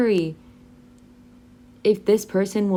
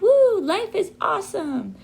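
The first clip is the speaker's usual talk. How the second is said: much higher cheering whoop; 11 semitones higher than usual. Shown as a demonstration. Teacher acting out a cheerful person cheering. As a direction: cheer it like life is great. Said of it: "you're like".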